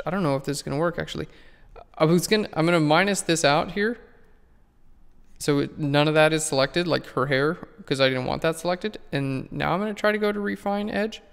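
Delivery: ordinary talk, nothing marked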